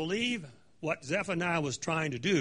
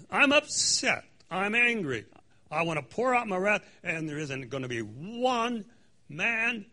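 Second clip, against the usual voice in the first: angry God; 3 semitones higher, 4 dB louder than usual. Acted. Preacher paraphrasing God's anger at the land, furious. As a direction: let the wrath boil over. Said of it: "It says".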